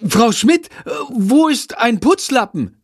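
high, flustered asking; excited pupil. A narrator's reading. Pupil who spilled the water asking teacher, high and flustered. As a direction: blurt it out in a flap. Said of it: "fragt ein Mitschüler aufgeregt".

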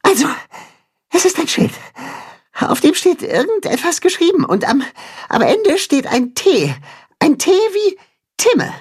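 high, squeaky mole voice reporting in a rush; a mole just out of the tunnel; lightly breathless. A narrator's reading. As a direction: gasp out the news after running. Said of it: "berichtet außer Atem".

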